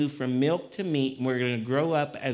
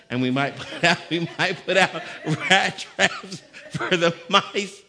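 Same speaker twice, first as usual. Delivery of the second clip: laughing; 5 semitones higher, 6 dB louder than usual. Real, not acted. Preacher joking with the congregation, laughing. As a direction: chuckle while joking about traps. Laughing at his own joke.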